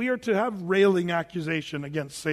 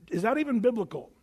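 usual speaking voice

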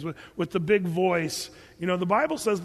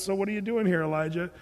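normal talking voice

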